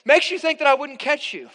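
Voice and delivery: put-on high, loud exasperated yell at a child; mock yelling at son. Dad yelling at son on the diving board, mock exasperated yell. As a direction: blast it out like a fed-up dad losing patience. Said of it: "talking like this".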